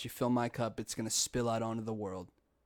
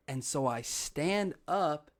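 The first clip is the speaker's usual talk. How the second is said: ordinary delivery, nothing marked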